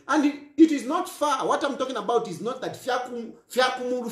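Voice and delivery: ordinary talk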